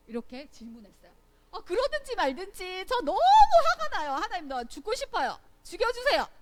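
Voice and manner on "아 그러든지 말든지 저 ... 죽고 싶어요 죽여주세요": loud, high exasperated outburst; acted fury. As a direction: protest loudly, pitch jumping at the end. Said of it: "이렇게 할 정도로 너무 화가 났어요". (크고 높은 목소리로 화내며 따지는 연기)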